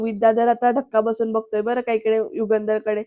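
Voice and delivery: ordinary talk, nothing marked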